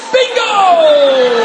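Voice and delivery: high, loud, drawn-out cheer; big triumphant retold shout; exultant yell. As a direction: holler it like a winning discovery. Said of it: "Actually, he yelled".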